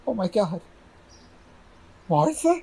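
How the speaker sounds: high, breaking disbelieving cry; astonished. Acted. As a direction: cry her name in disbelief. Sees dead wife.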